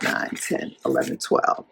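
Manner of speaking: counting under the breath; whispered counting. Real, not acted. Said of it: "counting trails to whisper".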